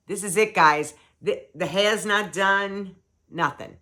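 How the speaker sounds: big reveal of her undone hair; excited reveal. Real, not acted. Showing herself on camera.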